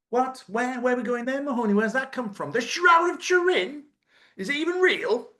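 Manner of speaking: high, disbelieving questions; mock outrage at the topic; incredulous. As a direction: squeal out the disbelief. Incredulous high-pitched reaction.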